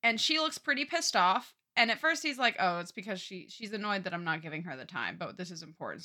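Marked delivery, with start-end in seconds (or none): 2.6-6.0 low, soft, retold musing to oneself; muttered inner thought. Husband reasons away her annoyance, muttered low. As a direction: voice his thoughts under the breath.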